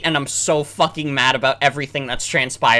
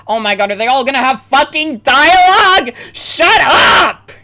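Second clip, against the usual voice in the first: real outburst, high and very loud; exasperated yell; 9 semitones higher, 10 dB louder, slower than usual. Real, not acted. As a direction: build from fed-up complaint to a screamed order. Fed up with endless game dialogue.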